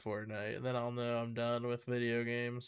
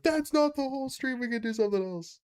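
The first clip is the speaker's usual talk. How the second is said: mock viewer voice; 10 semitones higher than usual. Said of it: "then you're like".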